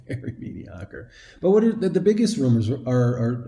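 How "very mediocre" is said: laughing whisper. Real, not acted. Laughing at own stumbles.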